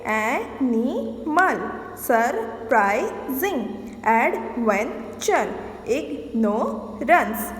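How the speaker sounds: each word stretched slowly, syllables spaced out. Shown as a demonstration. Teacher sounding out example words for the class, slowly. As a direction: sound out every beat for the pupils. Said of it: "How many syllables are there?".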